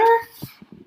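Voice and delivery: loud high gasp. Acted. Teacher gasps on spotting the elephant's ear. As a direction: gasp in amazement.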